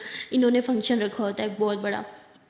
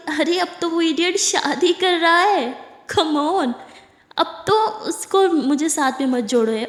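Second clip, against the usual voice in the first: higher, slow, pressing protest; exasperated explaining; 6 semitones higher, 3 dB louder, slower than usual. Acted. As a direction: spell it out, pitched up and insistent. (اونچی، دھیمی، زور دیتی جھنجھلاہٹ)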